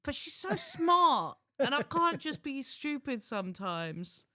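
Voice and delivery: high, loud, drawn-out complaint; exasperated. Real, not acted. Husband grumbling about his clever wife, exasperated. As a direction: stretch it out in comic frustration.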